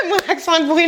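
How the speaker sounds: laughing at her own bad accent. Real, not acted.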